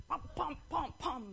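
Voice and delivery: playful "pam" impacts; thrashing noises. Acted. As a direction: pound out each vocal thud. Imitating elephant thrashing the lion.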